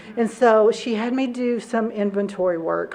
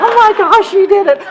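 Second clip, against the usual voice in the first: excited cheer; 10 semitones higher, 9 dB louder than usual. Retold or imitated. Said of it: "It's like".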